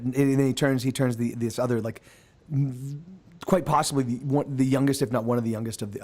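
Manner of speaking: usual speaking voice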